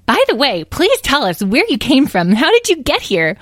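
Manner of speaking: put-on high, loud, eager child-dragon voice; excited young dragling. Young dragling asks Smudgy where he came from, excited. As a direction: pipe up loudly with curious excitement.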